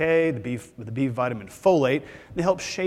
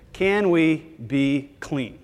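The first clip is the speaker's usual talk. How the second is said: ordinary talk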